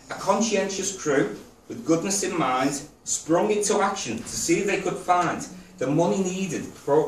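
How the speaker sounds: rhythmic spoken-verse delivery; poem recital. Real, not acted. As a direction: recite it line by line, pausing after each phrase. Reads own poem to audience.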